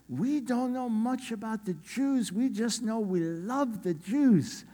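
put-on high, slow, earnest young woman's voice; young Malaysian woman. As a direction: draw it out high, heartfelt and simple. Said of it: "one young lady from Malaysia".

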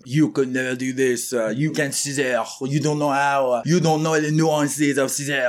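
haughty hammed-up French accent. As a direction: scold them in a thick, mock French accent. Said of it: "something to say in a French accent".

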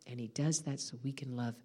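low, soft, lingering delivery; tender awe. Real, not acted. Preacher speaks of God's love to congregation, tender awe. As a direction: hush it into quiet wonder.

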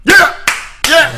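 high, loud cheers; cheering yell. Real, not acted. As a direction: whoop him on. Cheering as he drinks.

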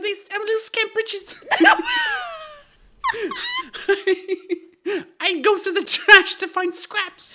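very high squeaky little scamp; Little Scamp Britches. Shown as a demonstration. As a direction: pipe it up like a pitiful street urchin. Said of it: "which would have been like".

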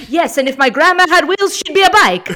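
loud, high, appalled comeback; horrified retort. Retold or imitated. Italian chef retorting to the host, loud and appalled. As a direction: hit back at a silly idea in horror. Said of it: "looks at her with horror".

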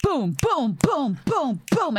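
string of high, falling booms; imitated explosions. Mimicking the action trailer, repeated cartoonish booms. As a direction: punch out mock explosion noises.

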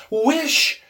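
high, loud, one-word cry; strong command. A narrator's reading. Wife commands her frightened husband, strong cry. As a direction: command him forcefully. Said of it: "she cried in a strong voice".

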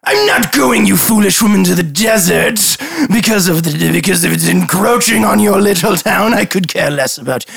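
grand dismissive noble, stretching the last words; scornful lord. Acted. Lord scolds the guard about the desert, scornful.

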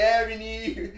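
teasing Baltimore take on "Avenue"; Baltimore accent. Retold or imitated. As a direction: drawl out "Avenue" like a Baltimore local. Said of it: "plus the Baltimore accent".

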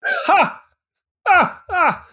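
sharp high barks of laughter. Real, not acted. Bursts out laughing at ending.

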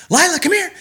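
excited call. Shown as a demonstration. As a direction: sing out her name like it's walk time. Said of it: "If I'm excited and I wanna take her on a walk".

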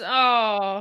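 ordinary talk

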